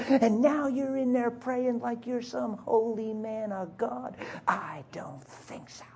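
hissed, slow, scornful whisper of an angry wife; wife's contemptuous whisper. Retold or imitated. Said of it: "guess what she said".

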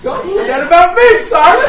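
loud, high, overjoyed outburst; elated. Real, not acted. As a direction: shout it to the room, thrilled and laughing. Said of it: "Oh, I feel good right now".

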